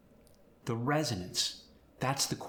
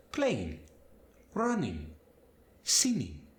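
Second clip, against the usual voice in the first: Spanish accent; slower than usual. Shown as a demonstration. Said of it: "the G is gonna just kinda fade".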